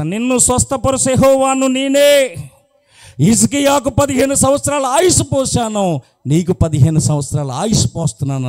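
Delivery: high, loud, booming divine proclamation; proclaiming voice of God. Acted. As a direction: announce it like the Lord himself speaking. Servant proclaims God's word. (దేవుని స్వరంలో గంభీరమైన ప్రకటన)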